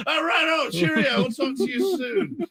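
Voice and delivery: very high, chirpy mock British accent; exaggerated British farewell. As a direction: sign off as a hammed-up Englishman. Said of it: "like, [name] on me".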